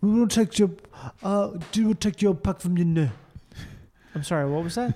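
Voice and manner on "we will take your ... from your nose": put-on thick Afrikaans accent English. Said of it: "He was like".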